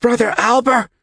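put-on high, loud breathless child; out-of-breath gasp. A narrator's reading. Winded boy to the monk at the door, gasped. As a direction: gasp the name, high and urgent. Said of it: "Yonkel gasped, still out of breath".

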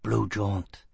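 a hint of rough gutter talk; low, blunt, halting; gruff sailor. Foyle's voice.

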